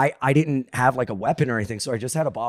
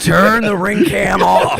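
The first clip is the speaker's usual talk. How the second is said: loud, high, drawn-out mock order; mock panicked shout; 6 semitones higher, 8 dB louder, much slower than usual. Joking that the pool hosing looks bad, mock panicked shout. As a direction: holler it slowly in joking alarm. Acts out caught-on-camera panic.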